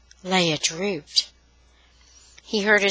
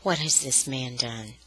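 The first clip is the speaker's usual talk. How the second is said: quiet demand; 7 semitones lower, 7 dB quieter than usual. Acted. Brother now quieter, low.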